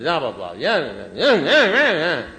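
high, loud nagging yammer; mock griping. Speaker parodies his rant to sponsor about his wife, mock griping. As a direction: rattle off a whiny rant that climbs and blurs.

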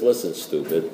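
low, soft, understated insult; retold calm put-down. Sponsor sets caller straight, quietly and low. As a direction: drop low and quiet for an affectionate jab.

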